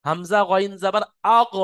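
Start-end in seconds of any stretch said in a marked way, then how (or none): 1.4-1.5 আগ with a drawn-out throaty gh; ghayn articulation demo. Shown as a demonstration. Teacher shows the push on ghayn, demonstrating. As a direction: push the end of আগ against the throat. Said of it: "এই গইনে যে ধাক্কাটা লাগছে".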